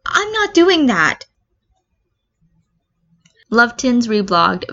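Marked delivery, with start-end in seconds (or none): 0.0-1.2 put-on high, slow frightened girl; scared Uraraka. Uraraka realizes a ghost is juggling, high and slowly scared. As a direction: let the fear creep in slowly. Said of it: "Uraraka says".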